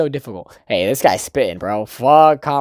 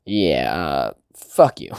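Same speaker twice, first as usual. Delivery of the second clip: lower, slow, bored brush-off; cat mode; 11 semitones lower, much slower than usual. Retold or imitated. Imitating Chizu's cold cat mode brushing off Kazuya. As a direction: drop the voice and drag it out dismissively. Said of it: "her Chizu cat mode where she's just like".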